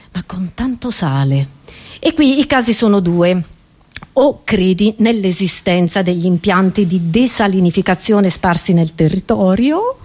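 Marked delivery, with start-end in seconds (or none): none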